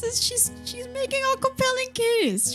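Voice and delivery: high, emphatic excited remark. Real, not acted. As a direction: stress compelling, thrilled.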